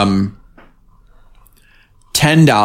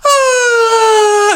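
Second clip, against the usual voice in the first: put-on high, delighted girlish squeal; mock swoon, much higher; 3 dB louder than usual. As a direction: squeal like a line of smitten girls. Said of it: "they're all like".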